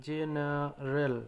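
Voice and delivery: General drawn out slowly, syllable by syllable; slowly spelled out. Shown as a demonstration. Teacher showing students the word, said very slowly. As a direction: stretch general out slowly so learners catch each sound. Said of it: "জ যেমন হচ্ছে general".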